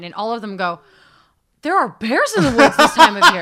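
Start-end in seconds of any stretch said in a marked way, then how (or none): 1.6-3.4 high, loud, astonished exclamation; alarmed warning. Retold or imitated. Locals warn a lone hiker about bears, loud and alarmed. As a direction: imitate shocked locals crying out a warning.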